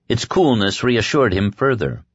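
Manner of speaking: plain reading voice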